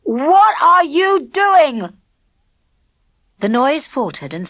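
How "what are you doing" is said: slow, loud thundering demand; furious shout. A narrator's reading. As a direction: roar it out terribly. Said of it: "thundered Spag in a terrible voice".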